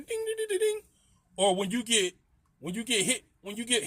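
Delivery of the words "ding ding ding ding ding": high, quick ding-ding of video game coins; imitated coin dings. Telling a friend about the game sounds, high chiming dings. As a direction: chime it like Sonic coins ringing. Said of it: "you can hear 'em ringing".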